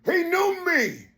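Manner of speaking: fiery sermon cadence rising to shouts; preaching. Acted. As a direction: preach it hard to the crowd. Preaching at full force.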